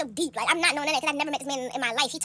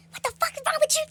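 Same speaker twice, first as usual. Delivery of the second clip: angry mother; 13 semitones higher than usual. Retold or imitated. Mother yelling at party.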